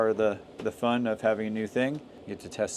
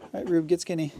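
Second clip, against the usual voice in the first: high, sing-song voice for the dog; high dog talk; 8 semitones higher, faster than usual. Real, not acted. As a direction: coo at the dog.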